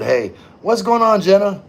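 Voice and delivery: ordinary talk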